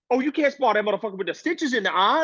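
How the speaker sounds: high, alarmed imitation of the older man; hammed-up shocked trainer telling him off; mock Bill Haney. Bill Haney warning him not to spar, high mock alarm. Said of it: "he's like".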